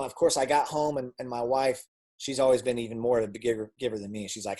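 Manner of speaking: ordinary talk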